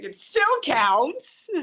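high, drawn-out comic insistence; mock protest. Guest defending her birthplace claim, high mock protest. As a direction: insist slowly in a high, playful voice.

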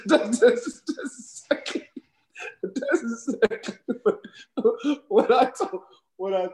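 high laughing through the words; cracking up mid-sentence; amused at his own story, laughing. Real, not acted. Laughs retelling the comeback.

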